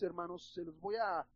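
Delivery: ordinary talk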